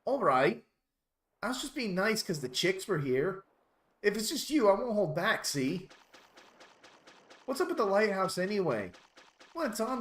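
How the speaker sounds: high-pitched, proper British character voice; prim and proper English. Acted. Dolphin's translated lighthouse complaint, prim and proper British. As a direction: threaten them with prim English manners. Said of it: "prim and proper as possible".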